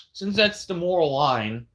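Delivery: ordinary talk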